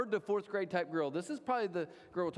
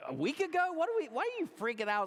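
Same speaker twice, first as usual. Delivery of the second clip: put-on much higher, whiny teenage girl voice; mock teen daughter; 12 semitones higher than usual. Pastor mimicking a teen daughter for the congregation, high mock whine. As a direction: squeak it out like a dramatic teen.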